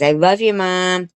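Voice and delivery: small voice lifting on "Mom"; Zach. Retold or imitated. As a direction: imitate a little voice trying to be heard. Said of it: "he's got that little voice".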